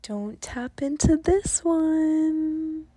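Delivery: slow menacing devil voice; a devil tempting, drawn out; slow theatrical villain menace. Acted. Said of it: "said the devil".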